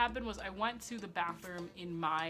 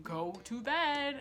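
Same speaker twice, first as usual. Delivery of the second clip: drawn-out, higher mock scolding; exasperated order; 4 semitones higher, much slower than usual. Woken relatives ordering teen to bed, exasperated. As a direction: imitate a fed-up group, stretching each word.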